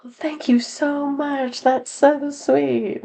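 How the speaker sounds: high, slow, gushing thanks in a put-on voice; grateful couple. Couple thanking the photographer, slow, high and gushing. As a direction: draw out the thanks, sugary and high. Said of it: "And they're like".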